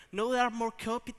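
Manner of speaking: ordinary talk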